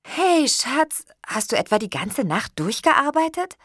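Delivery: high, lively greeting to a partner; eager, high-pitched hello; cheerful greeting. A narrator's reading. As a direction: greet your partner brightly, pitch lifting. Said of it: "begrüßte ich ihn".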